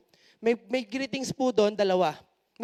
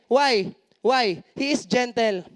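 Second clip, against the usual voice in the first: normal talking voice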